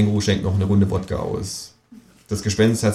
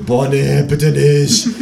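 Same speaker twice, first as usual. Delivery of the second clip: loud, raised-pitch plea; groaning protest; 6 semitones higher, 5 dB louder, slower than usual. A narrator's reading. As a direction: protest loudly as if two at once. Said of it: "sagen das Gespenst und ich gleichzeitig".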